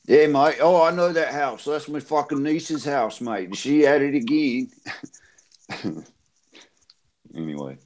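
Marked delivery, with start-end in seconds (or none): 0.1-4.7 mock Aussie bogan voice; Australian bogan accent. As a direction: play a local in broad Australian. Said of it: "you can do the accent".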